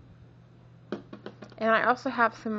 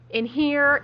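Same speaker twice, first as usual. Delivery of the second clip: sick voice; 5 semitones higher, 6 dB louder than usual. Real, not acted. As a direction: voice rough from a cold. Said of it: "my voice is all funky".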